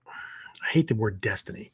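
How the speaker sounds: a quick hushed confession to the room; whispered aside. Real, not acted. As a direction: whisper it under your breath. Quick whispered aside mid-lecture.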